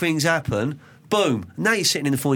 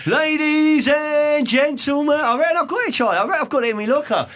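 ring announcer; 12 semitones higher than usual. Shown as a demonstration. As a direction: belt it out like a fight-night MC. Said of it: "I could be the ring announcer".

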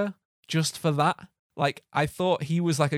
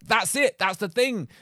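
higher, re-enacted eureka moment; excited realisation; 6 semitones higher, slower than usual. Podcaster retelling how he placed the actor's voice, mock eureka. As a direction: burst out as if it just clicked.

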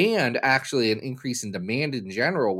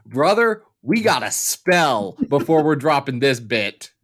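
louder, hammed-up wrestler promo; wrestling voice; 3 dB louder than usual. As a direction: put on a piratey pro-wrestler voice. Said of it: "trying to do a wrestling voice".

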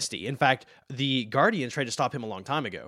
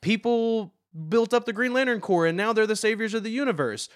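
higher, lightly hammed-up mock enthusiasm; mock fan cheer; 8 semitones higher, 3 dB louder than usual. A podcaster mocking the endless reboots, higher sarcastic cheer. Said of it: "never-ending issue of, like".

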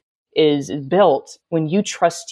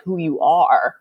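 usual speaking voice

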